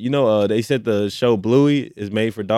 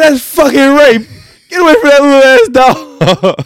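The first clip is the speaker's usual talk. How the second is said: high, loud imitated yell; mock yelling bystander; 10 dB louder than usual. Friend retelling the man who yelled at the humping dog. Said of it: "He said".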